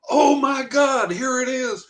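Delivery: high, loud burst of amazement; thrilled retold cry, drawn out at first; excited outburst. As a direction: exclaim it like a long-awaited wish come true. Retelling excited discovery of email.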